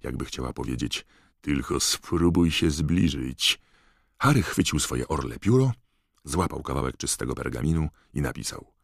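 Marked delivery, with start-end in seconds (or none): 1.4-3.6 powolne, dobitne ostrzeżenie; wycedź to powoli, z wyższością; chłodna groźba. A narrator's reading. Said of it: "zmierzyła ją chłodnym spojrzeniem, jakby chciała powiedzieć". (chłodne, groźne ostrzeżenie)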